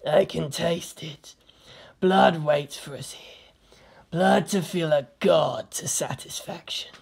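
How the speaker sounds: slow hissed threat, voice changed; hissing Chaos Marine. A narrator's reading. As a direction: hiss it like a hungry warrior of chaos. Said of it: "hissed the Chaos Marine".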